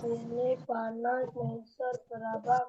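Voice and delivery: choppy cutting-out audio; broken-up voice. Real, not acted. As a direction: voice breaking up over a bad connection. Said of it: "Patah-patah suaranya".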